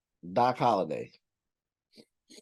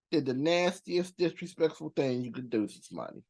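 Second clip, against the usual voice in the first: usual speaking voice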